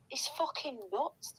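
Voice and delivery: normal talking voice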